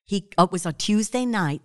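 normal talking voice

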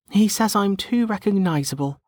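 put-on high-pitched, dismissive young woman; shrugging young woman. A narrator's reading. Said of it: "She settled on a shrug".

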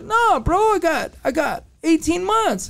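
high-pitched protesting correction; retold insistence. Inmate clearing up eighteen months to a friend, insistent. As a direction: imitate an inmate protesting a misunderstanding.

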